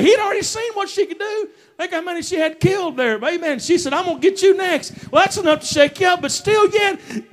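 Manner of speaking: high-pitched, urgent sermon delivery; full-force preaching. Acted. Preacher telling how God answered Elijah's fear, full-force preaching. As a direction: press the point home with fervor.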